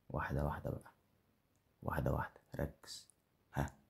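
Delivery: breathy whispered reminder to focus. Real, not acted. Gamer whispering to himself to focus. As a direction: hush it to yourself.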